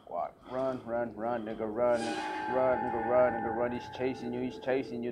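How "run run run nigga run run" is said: ordinary delivery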